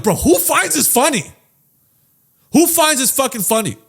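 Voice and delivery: high-pitched, indignant mock challenge; exasperated outburst. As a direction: demand it like an annoyed moviegoer. Acted outburst at the comic character.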